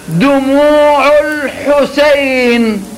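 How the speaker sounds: "دموع الحسين" stretched very slowly from chest to head; very slow resonant demo. Shown as a demonstration. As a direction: draw out "دموع الحسين" as a model. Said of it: "قل".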